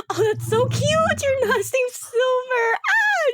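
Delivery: put-on high, slow, gushing girly voice; gushing NPC. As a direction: coo it like a delighted fangirl.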